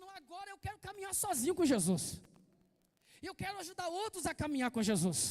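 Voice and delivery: loud, proclaiming with resolve; bold declaration. Acted. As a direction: shout it out like a vow. Voices a believer's bold declaration.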